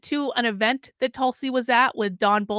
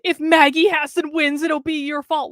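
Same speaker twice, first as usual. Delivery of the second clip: higher, louder mock accusation; pitched-up mock blame; mocking supporters; 5 semitones higher, 4 dB louder than usual. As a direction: imitate angry supporters blaming you. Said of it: "Don Bolduc supporters could say is".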